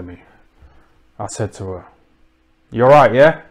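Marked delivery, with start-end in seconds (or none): 2.7-3.4 loud, high hail across the street; loud street greeting; called-out greeting. Retold or imitated. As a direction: shout a cheery hello at her. Calling out to passing woman.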